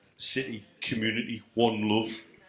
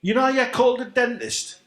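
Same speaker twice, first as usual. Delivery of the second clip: high, louder mock voice, teasing; 12 semitones higher, 7 dB louder than usual. Friend ribbing him about his dentist nickname, high mock voice. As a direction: pitch it way up like a cheeky jab.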